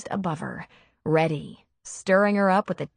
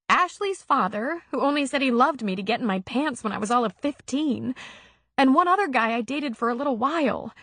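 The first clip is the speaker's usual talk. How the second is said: put-on higher, tender young woman confiding; heroine's voice; 7 semitones higher than usual. A narrator's reading. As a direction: let her open up, still emotional. Narrator voices Gina confiding in Mac.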